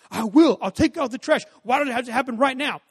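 put-on sulky teenager protesting; whiny teen.

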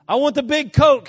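high, very loud mock tantrum; hammed-up insistent demand; bratty demand. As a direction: yell it like a spoiled child. Acted childish demand.

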